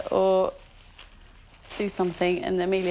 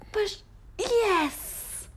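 excited cheer; 12 semitones higher than usual. Real, not acted. Cheering child on during stretches.

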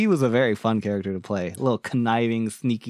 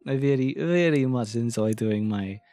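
playful fake Russian character accent; bad Russian accent. Introducing a D&D death priest, bad Russian accent. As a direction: slip back into a mock Russian accent. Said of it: "he's a very fun, uh, voice to do".

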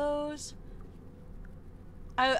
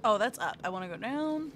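ordinary delivery

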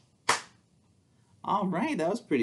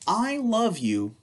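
slow, playful picture-book read; reading to children; slower than usual. Acted. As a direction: read it aloud to little ones with big expression. Reads picture book to kids.

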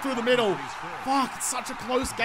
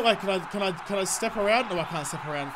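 normal talking voice

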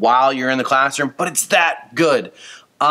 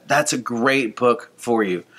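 usual speaking voice

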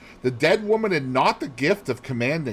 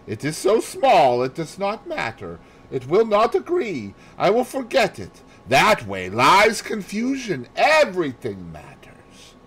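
higher, lecturing detective; Poirot voice; 9 semitones higher, 3 dB louder than usual. Acted. Poirot warns Hastings against dismissing details, lightly higher. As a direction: scold the careless detective.

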